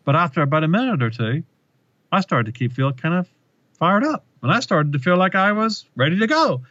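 a hint of Obama warming to the crowd, voice climbing; Obama voice change. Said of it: "his voice changes and he goes".

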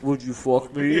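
lightly imitated creepy movie killer; a hint of creepy self-seduction; Buffalo Bill. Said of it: "he's Buffalo Bill in that shit".

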